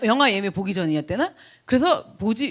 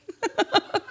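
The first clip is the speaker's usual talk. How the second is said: high burst of laughter; 11 semitones higher, 3 dB quieter than usual. Real, not acted. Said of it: "엽기적이야".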